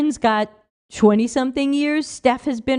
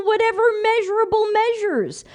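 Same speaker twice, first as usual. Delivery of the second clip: higher, mocking sing-song; sarcastic mockery; 10 semitones higher than usual. Mocking a critic's demand for measurable evidence, sarcastic. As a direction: tease the pompous phrase.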